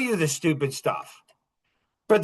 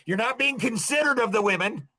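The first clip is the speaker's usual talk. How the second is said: ordinary delivery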